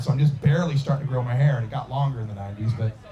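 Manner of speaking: ordinary talk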